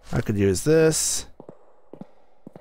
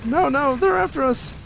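rising panic, much higher; frantic, climbing higher as they close in; being chased; 8 semitones higher than usual. Real, not acted. Chased by shadows in the elevator.

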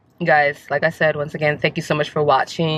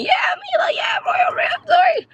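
high shouted cheer replayed; retold scream. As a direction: relive yelling at the rink. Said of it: "I was screaming".

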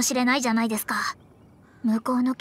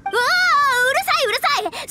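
flustered; 9 semitones higher, 3 dB louder, slower than usual. Acted. Flustered denial of hunger.

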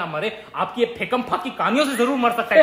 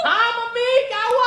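high-pitched called-out question; exasperated high reply to mom; calling back, irritated; 4 dB louder than usual. Acted. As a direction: call across the house to mom. Answers repeated calls from mother.

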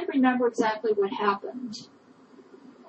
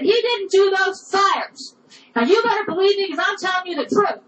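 angry insistence; 9 semitones higher, 4 dB louder than usual. Retold or imitated. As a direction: fight for the boy, every word pushed out. Wife shouting down husband.

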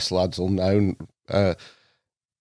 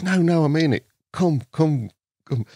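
eager insistence; 7 semitones higher than usual. Retold or imitated. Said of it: "and he goes".

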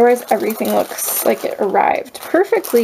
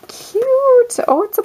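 delighted squeal; 13 semitones higher than usual. Real, not acted. Spotting a cute postcard in the parcel, squealed high.

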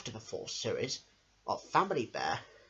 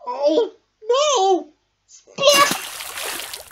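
very high, loud squeal; cartoon alarm; 13 dB louder, much slower than usual. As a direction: squeal then splash. Bear falls into pond.

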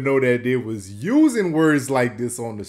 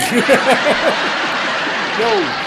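loud, high burst of laughter; real loud belly laugh; cracking up; 8 semitones higher, 7 dB louder than usual. Real, not acted. Host cracks up at punchline.

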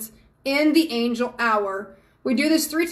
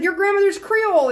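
delighted surprise; 8 semitones higher, 4 dB louder than usual. Retold or imitated. I absolutely loved her accent.

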